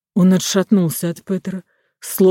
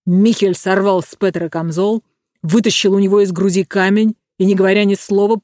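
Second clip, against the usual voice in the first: ordinary delivery, nothing marked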